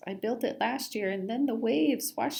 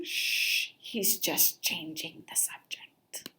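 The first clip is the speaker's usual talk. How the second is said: slow secret whisper; hushed aside to the listener; 3 semitones lower, 6 dB quieter, slower than usual. Acted. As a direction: whisper it behind his fin.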